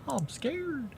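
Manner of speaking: tiny, high, soft mock-frightened voice; mock scared. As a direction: squeak it softly, pretending fear.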